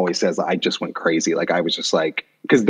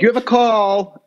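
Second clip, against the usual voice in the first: stepfather announcing; 12 semitones higher than usual. Retold or imitated. Imitating stepfather announcing a call.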